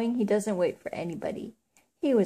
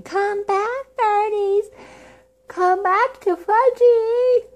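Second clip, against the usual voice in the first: high, loud, stretched-out holler; little boy screaming; 13 semitones higher, 8 dB louder, much slower than usual. A narrator's reading. Little boy chasing off pigeons, high-pitched screaming. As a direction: scream after the birds in a very high child's voice. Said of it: "was screaming".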